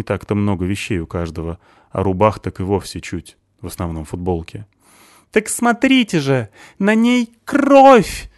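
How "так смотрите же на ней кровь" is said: high, loud wail, dragged out slow on the last words; wailing cry. A narrator's reading. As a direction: howl it to the crowd. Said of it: "возопил Стасик".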